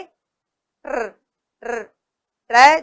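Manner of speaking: ट्र with the tongue curling on र; slow ट्र, let the tongue roll; rolled tongue sound. Shown as a demonstration. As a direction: model ट्र with a turning tongue. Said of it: "घूमती हुई हमारी जीभ".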